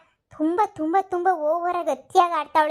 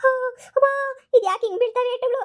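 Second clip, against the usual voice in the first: whimpering in pain; 3 semitones higher than usual. Acted. Grandmother cries under ghost's blows.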